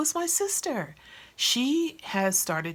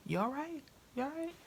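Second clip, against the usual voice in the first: higher, softer mock concern; worried disbelief; 8 semitones higher, 7 dB quieter, faster than usual. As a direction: ask like she might be losing it. Retold concern at friend's fast.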